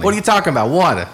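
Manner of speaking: put-on loud, high East Coast accent on "Water"; mock East Coast city accent, punched out. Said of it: "Boston threw 'em off?".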